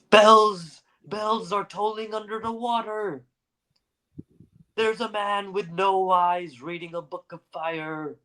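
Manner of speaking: high, haunted deathbed raving; delirious visions, half chanting; raving dying poet. Acted. Poe raves on his deathbed.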